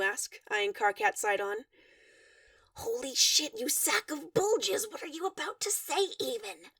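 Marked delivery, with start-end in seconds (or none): 2.7-6.7 hissing. A narrator's reading. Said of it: "Karkat hisses at him".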